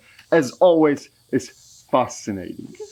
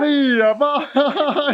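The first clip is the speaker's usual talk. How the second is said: fake Italian; 12 semitones higher, slower than usual. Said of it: "Fake Italian".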